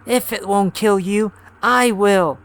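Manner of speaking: put-on high shrieking old woman; screaming. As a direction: scream it in rage. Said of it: "she screamed".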